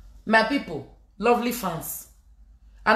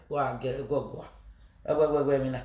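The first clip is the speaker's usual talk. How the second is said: ordinary delivery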